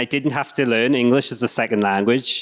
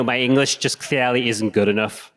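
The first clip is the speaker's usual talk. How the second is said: ordinary delivery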